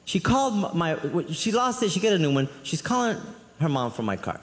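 usual speaking voice

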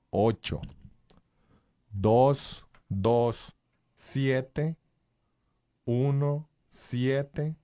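very slow digit dictation, long pause after each; slowly, for note-takers. Real, not acted. Host repeats the number for listeners finding a pen, very slow dictation. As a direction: spell out the number slowly so they can write it down. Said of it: "Lo voy a repetir así despacito".

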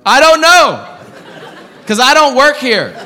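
high, loud annoyed answer; yelling back. Retold or imitated. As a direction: shout back, exasperated.

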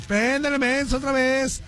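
high, drawn-out playful nagging; teasing high-pitched telling-off; mock scolding.

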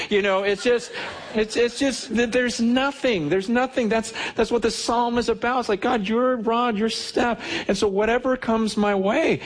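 high, fast, excited preacher voice; climbing, breathless sermon delivery; fired-up preaching. Acted. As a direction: preach it with rising passion.

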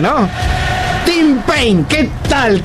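ordinary talk

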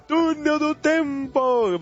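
high, long-held call, stretching every vowel; playful drawn-out shout. Pretend. Host giving a listener the requested catchphrase, playful drawn-out shout. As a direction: holler it like a show catchphrase, high and slow. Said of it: "ela quer ver a gente gritando".

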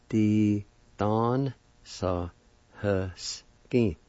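"Di don sa husky" slow, syllables held apart. Shown as a demonstration. Teacher repeats pickpocket word slowly, syllable by syllable. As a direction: draw out "Di don sa husky" part by part. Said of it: "like this".

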